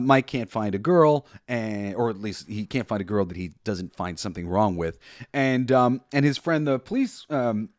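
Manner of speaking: ordinary delivery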